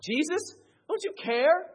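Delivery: high, loud, hurting cry; a loud high anguished prayer; anguished plea. Retold or imitated. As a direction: cry out in heartbreak. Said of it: "You ever said".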